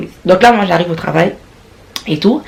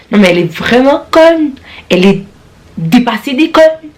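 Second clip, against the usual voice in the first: exasperated rant; 6 semitones higher, 3 dB louder than usual. Real, not acted. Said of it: "ranting about the coworker".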